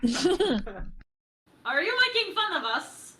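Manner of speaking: put-on slow, drawn-out Russian accent; Russian accent, mock offended. Player asks the GM in a Russian accent. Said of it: "in my Russian accent".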